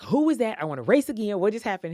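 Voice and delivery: high, loud retold indignant outburst; upset outburst. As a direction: replay her eighth-grade fury, hammed-up. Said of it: "and I was upset".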